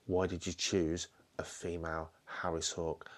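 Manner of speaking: ordinary delivery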